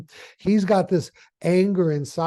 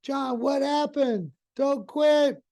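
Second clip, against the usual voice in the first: higher and slow, pleading with him; a much higher, slowed plea not to quit; worried buddy; 9 semitones higher, slower than usual. Retold or imitated. Best buddy begs John not to quit, high and pleading. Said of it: "his best buddy says".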